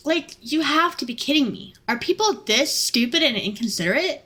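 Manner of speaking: ordinary talk, nothing marked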